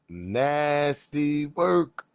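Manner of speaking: "Nasty work" stretched very slow, "work" falling; drawn out. Real, not acted. Commentator lingering disgustedly over the caller's story, very slow. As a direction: savour each word of "Nasty work" slowly.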